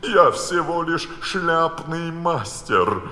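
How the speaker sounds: a hint of the nervous Hatter pleading; anxious Hatter. A narrator's reading. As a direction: ask to leave with a slow drawl. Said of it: "обеспокоенно поглядывая на королеву".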